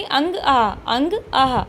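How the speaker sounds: आह ending in an audible "ha" breath; h-like visarga. Shown as a demonstration. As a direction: contrast अंग with आह, releasing a clear h. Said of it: "हा की ध्वनि निकल रही".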